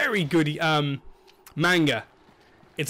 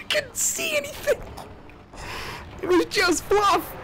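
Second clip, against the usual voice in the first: very high, strained near-sob shout; half-crying yell. Real, not acted. Gamer crying out after getting blinded by the attack. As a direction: howl in disbelief.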